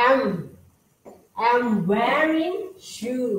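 very slow, word by word; slow reading. Shown as a demonstration. Teacher reads slowly so students can follow. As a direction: read it out slowly for the class. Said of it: "ช้าๆ ก่อนนะคะ".

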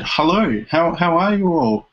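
put-on retelling a greeting in a faint German accent. Posing as twin brother arriving from Germany, light German accent. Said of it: "in sort of a German accent".

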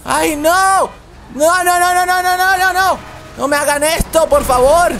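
real alarm as the race slips away; panicked shout. Real, not acted. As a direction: racer wailing at the other drivers to stop. Boxed in and losing positions.

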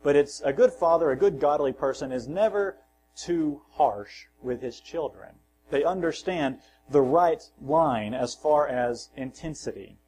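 ordinary talk

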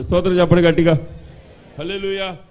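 loud call to praise, hitting hallelujah; preaching shout. Real, not acted. Pastor urging the congregation, loud call. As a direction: rouse the crowd to shout praise.

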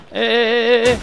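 high, loud panicked shouts; frantic yelling. Real, not acted. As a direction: yell in a rush of panic. Frantic moment in firefight.

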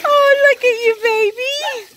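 high, loud delighted cooing; gushing pet talk. Real, not acted. Owner admiring her dog in the water, gushing baby talk.

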